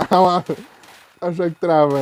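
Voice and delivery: sudden loud shout; loud exclaim. Real, not acted. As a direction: shout at the frozen game. Game freezes, loud outburst.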